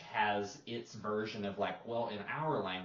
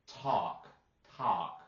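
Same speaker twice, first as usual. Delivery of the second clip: "Talk" said as "tock" with no L and an open vowel; Mississippi accent; 4 dB louder than usual. Shown as a demonstration. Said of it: "They'll make it sound like T O C K".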